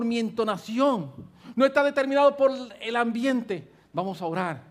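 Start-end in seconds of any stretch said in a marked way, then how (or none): none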